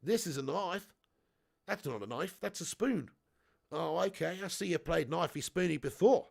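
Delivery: broad mock-Australian accent; hammed-up Aussie bushman accent with swooping pitch; Crocodile Dundee. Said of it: "great Crocodile Dundee impression".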